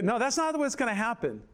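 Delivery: normal talking voice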